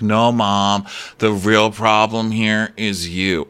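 slow, deliberate, level delivery; son confronting mom. Retold or imitated. Son blaming his mom at dinner, slow and measured. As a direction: spell it out slowly in the son's voice.

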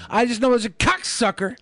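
lightly voiced blurt-out; blurting Tourette's outburst. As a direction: toss off the line like an outburst. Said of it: "they're like".

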